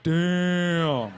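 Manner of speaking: one long drawn-out "damn"; impressed crowd reaction. Acted. Whole party reacts to a knockout, drawn-out awe. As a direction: stretch it out like an awed party crowd.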